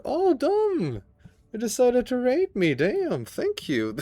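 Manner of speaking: high, loud startled thanks; excited surprise. Real, not acted. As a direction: caught off guard and thrilled by the raid. Surprised by an incoming raid.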